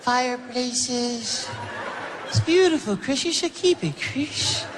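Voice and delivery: high, gentle Michael impression with a laugh; [name]. Michael renaming Chris as Christmas, high and sweet. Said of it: "I go".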